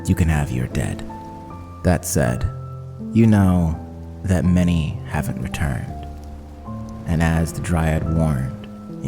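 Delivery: ordinary talk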